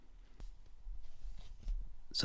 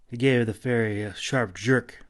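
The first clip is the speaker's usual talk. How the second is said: plain reading voice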